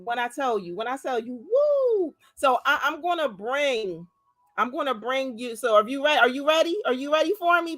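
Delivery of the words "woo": excited whoo. Real, not acted. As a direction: let out a delighted whoo. Bursting with excitement.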